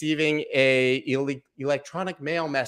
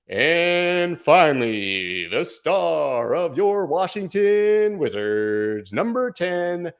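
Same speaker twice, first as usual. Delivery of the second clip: announcer voice; 5 semitones higher, much slower than usual. Pretend. Streamer announcing final winner as the team's star, booming announcer. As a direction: build it up like the star player's entrance. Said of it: "I have a pretty good announcer voice".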